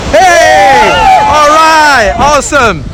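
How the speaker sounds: high, elated whoop easing down; excited cheer. Real, not acted. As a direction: celebrate with a big high "hey". Group cheer at the sign-off.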